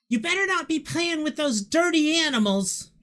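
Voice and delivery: high, loud scolding elder; loud, high-pitched nagging from indoors; older human voice. Acted. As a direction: holler a warning like an old guardian. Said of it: "This older human voice".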